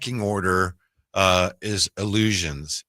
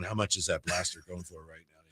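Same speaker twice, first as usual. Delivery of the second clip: muttered aside; 4 semitones lower, 13 dB quieter than usual. Retold or imitated. Muttered to himself.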